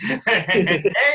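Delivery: a short laugh breaking into the bit. Real, not acted. Laughing while doing the Pilate cameo. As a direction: laugh between the shouts.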